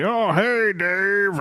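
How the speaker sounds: lightly voiced gruff older man, pitched high; a hint of Stanton's gruff hello, answering the phone. Stanton answers Dave's call, in a lightly imitated gruff voice. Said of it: "his gruff type of voice".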